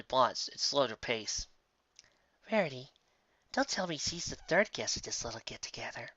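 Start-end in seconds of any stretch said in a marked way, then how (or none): 2.5-6.1 softer, uneasy mare; Sandalwood, wary. Acted. Sandalwood spots Applejack at lunch, softly wary. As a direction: back away from the table warily. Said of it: "Sandalwood said".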